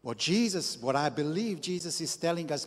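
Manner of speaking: ordinary delivery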